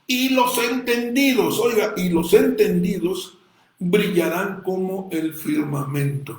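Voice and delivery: fiery preacher's cry; preaching at full force. Acted. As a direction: proclaim it from the pulpit. Preaching climax on Daniel verse.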